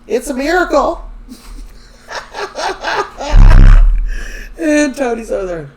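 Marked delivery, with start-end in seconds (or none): 0.1-1.0 high-pitched, louder gushing announcement; hammed-up excited exclamation; mock elation. Excited Anna claims a miracle pregnancy. 1.1-4.5 loud, high burst of laughter; long real belly laugh. Real, not acted. Cracks up at her own retelling.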